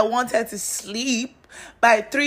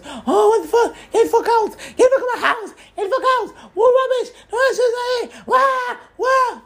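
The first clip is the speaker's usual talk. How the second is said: high-pitched shrieking impression of him yelling, half laughing; mock furious man. Imitating the guy screaming get out of my house, hammed-up and shrill-high. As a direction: squeal it out like a ranting man, cracking up. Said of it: "He now goes".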